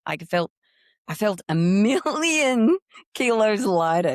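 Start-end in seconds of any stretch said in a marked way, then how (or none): 1.1-4.1 relieved laugh. Real, not acted.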